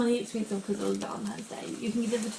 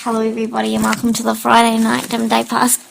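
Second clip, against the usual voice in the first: loud intro; 10 dB louder than usual. Real, not acted. Co-host doing podcast intro loudly.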